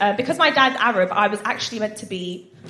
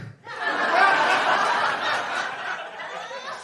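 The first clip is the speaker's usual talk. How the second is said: snorting laughs; 10 semitones higher, 4 dB quieter than usual. Real, not acted. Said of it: "I snort when I laugh".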